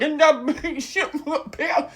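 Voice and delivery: very high, slow blubbering; hammed-up crying whine; mock tearful anger. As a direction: imitate a furious buddy in tears. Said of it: "He was in tears".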